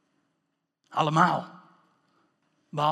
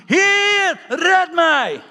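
high, full-force cry for rescue; desperate shout; 11 semitones higher, 12 dB louder, much slower than usual. Acted. Re-enacts the sinking disciple's cry. (wanhopig schreeuwen)

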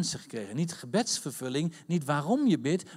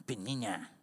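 mocking the name; 6 semitones lower, 7 dB quieter than usual. Shown as a demonstration. Preacher joking that the nastiness is in the name, mocking. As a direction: sneer the name lightly, lower and softer.

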